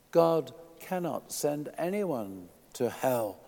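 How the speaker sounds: ordinary talk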